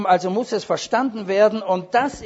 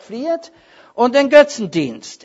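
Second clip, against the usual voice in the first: quoting Paul's command; 6 semitones higher, 6 dB louder than usual. Retold or imitated. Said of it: "und Paulus sagt".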